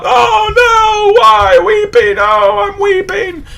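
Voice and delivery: high, loud mock wail of grief; imitating wailing mourners. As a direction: cry out like mourners wailing aloud. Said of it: "They're, they're crying out".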